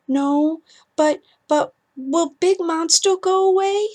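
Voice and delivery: put-on a small boy asking if the monster is gone; scared little boy. Ethan asks his mother about the monster, high and small.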